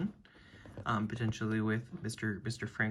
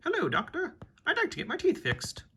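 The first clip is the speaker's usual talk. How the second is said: high patient voice. Acted. Vampire patient at the dentist.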